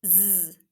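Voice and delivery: "Z" as a sustained buzz, not the letter name; letter sound zzz. Shown as a demonstration. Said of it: "the first sound I would like".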